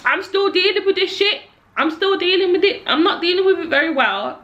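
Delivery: letting the anger spill out, pitched high; heated rant. Real, not acted. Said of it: "now I'm ranting 'cause I'm so mad".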